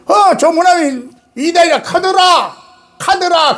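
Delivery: mocking hearsay.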